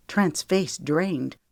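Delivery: plain reading voice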